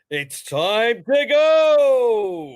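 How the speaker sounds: loud, high, hugely drawn-out call; hammed-up holler, stretching the last word; mock airline rallying cry. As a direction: bellow it slowly like a comic launch slogan. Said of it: "What do they do on Claudiola's Airlines?".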